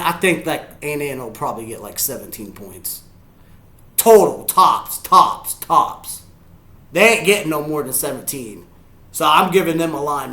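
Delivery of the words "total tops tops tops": "tops" punched out slowly, loud and high; emphatic repetition. Real, not acted. Fan capping Indiana at seventeen, emphatic "tops" repeated. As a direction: hit each "tops" separately, with gaps. Insisting on his score prediction.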